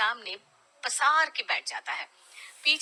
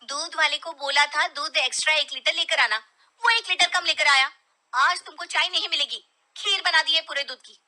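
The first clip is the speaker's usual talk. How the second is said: higher, louder nagging wife voice; annoyed wife; 6 semitones higher, 6 dB louder than usual. Acted. Wife refusing husband his tea, irritated and raised. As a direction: scold him for wanting more tea.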